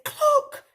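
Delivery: high, loud cluck; sudden high-pitched cluck; hen cluck. A narrator's reading. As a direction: imitate a hen with one sharp cluck. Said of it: "and the hen said".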